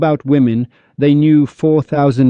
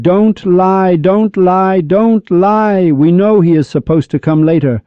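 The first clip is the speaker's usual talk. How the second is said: higher-pitched retold outcry, only mildly raised; imitated shouting, pitched up; mock crowd shout; 6 semitones higher, slower than usual. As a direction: chant it high as a protesting crowd, without full volume. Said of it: "the government shouted".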